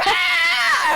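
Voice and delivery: high-pitched imitated screaming; mock crowd scream. Man imitates the crowd drowning out his line, mock screaming. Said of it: "they were screaming their ass off".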